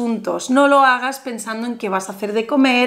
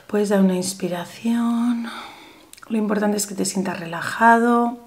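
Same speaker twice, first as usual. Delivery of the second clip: guided meditation; 4 semitones lower than usual. Acted. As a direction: lull the viewer into calm visualization. Leads viewer through visualization.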